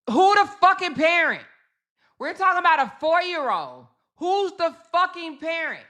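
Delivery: high, loud and disbelieving, hammering the question; exasperated outrage. Real, not acted. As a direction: demand it like you cannot believe it.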